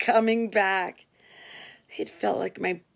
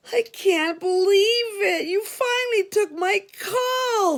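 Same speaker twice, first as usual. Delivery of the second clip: much higher, louder thrilled inmate voice; Frankie exclaiming; 12 semitones higher, 4 dB louder than usual. Retold or imitated. Inmate Frankie thrilled that his call finally went through. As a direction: gush like he can't believe his luck. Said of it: "Frankie exclaimed".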